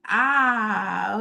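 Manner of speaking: loud open "ah" on the out-breath; audible release sigh. Shown as a demonstration. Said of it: "I want you to release audibly".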